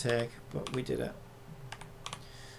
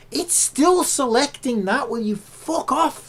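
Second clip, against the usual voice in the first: higher, louder, slow seething complaint; angry exasperation; 10 semitones higher, 7 dB louder, slower than usual. Real, not acted. At Photoshop selecting the wrong layer, slow high fury. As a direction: drag the words out in rising fury.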